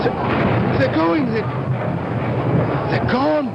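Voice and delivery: very high, panicked cries; horrified. Acted. Boy watches the little people being swallowed by the earth, horrified. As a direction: cry out in helpless horror, halting between phrases.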